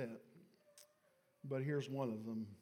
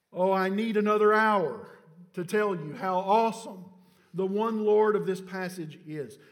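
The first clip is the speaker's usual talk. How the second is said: much louder, higher, fervent proclamation; full-force preaching; 5 semitones higher, 14 dB louder than usual. Real, not acted. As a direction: preach it out with rising fire. Preaching climax.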